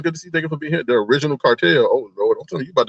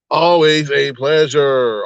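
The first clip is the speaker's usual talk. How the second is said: normal talking voice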